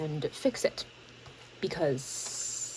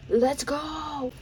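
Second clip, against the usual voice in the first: cheering, higher and brighter; excited; 8 semitones higher than usual. Real, not acted. Celebrating reaching last scene.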